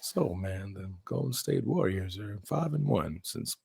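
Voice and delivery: very deep, very soft droning voice; mock monotone. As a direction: drone low and quiet like a sleepy robot. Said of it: "You sound so monotone".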